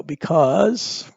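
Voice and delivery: usual speaking voice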